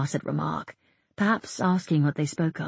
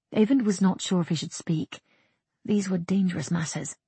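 usual speaking voice

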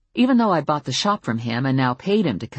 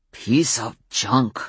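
put-on slow, low grumbling old mechanic; grumpy grousing; 3 semitones lower, much slower than usual. A narrator's reading. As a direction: grouse it out slowly, disgusted. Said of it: "groused Z".

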